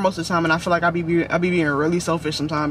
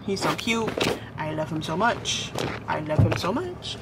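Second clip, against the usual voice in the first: tender murmur; 3 semitones lower, 6 dB quieter than usual. Real, not acted. Gushing over partner.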